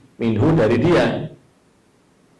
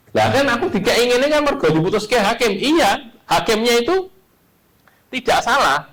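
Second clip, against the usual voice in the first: high, indignant excuse-making voice; protesting litigant; 11 semitones higher than usual. Acted. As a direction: whine that the judge decided it. (suara tinggi orang yang membela diri)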